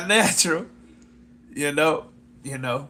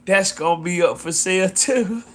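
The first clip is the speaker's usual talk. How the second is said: ordinary delivery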